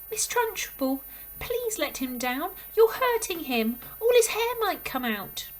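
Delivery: high, loud anxious plea; pleading cry. Acted. Miss Honey begs the headmistress to let the boy down, high and loud. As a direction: beg her to stop. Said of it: "Miss Honey cried out".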